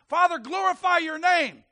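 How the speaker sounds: loud, high cry to the Father; ringing preacher's proclamation; crying out in prayer. Acted. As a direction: call out boldly to heaven. Voicing Jesus' cry to God.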